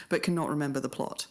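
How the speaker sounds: usual speaking voice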